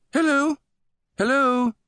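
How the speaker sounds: sing-song British shout from afar; sing-song British hail. A narrator's reading. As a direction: trill it out high, calling in a British voice. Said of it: "a sing-song British voice shouted".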